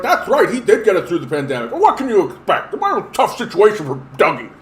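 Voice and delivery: mocking a naive Ford voter, laughing; dopey voter voice. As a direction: play a gullible supporter. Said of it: "people are gonna be like".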